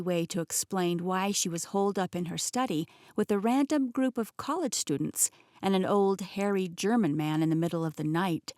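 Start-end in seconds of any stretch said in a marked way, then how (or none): none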